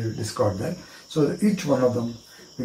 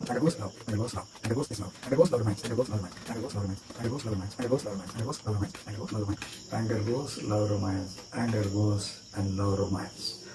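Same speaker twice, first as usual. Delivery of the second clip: much softer, steady repeated chant; quiet mantra; 3 semitones lower, 8 dB quieter, faster than usual. Shown as a demonstration. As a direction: murmur it card by card like a ritual. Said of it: "take the card and say".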